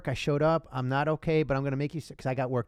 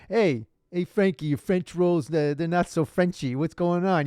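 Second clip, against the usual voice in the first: hammed-up Little Italy guy calling a pal; clumsy attempt at Italian-American street talk; badly faked Italian-American accent; 3 semitones higher, 4 dB louder than usual. Checking on Frankie, in a badly faked Italian-American accent. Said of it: "I don't know how to do an accent".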